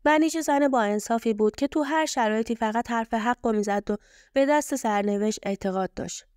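ordinary delivery, nothing marked

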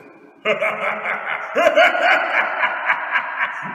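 long, loud villainous laughter; big booming robber's guffaw; villain's laugh. Acted. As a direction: laugh uproariously at the victim. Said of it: "Da lachte der fremde Mann".

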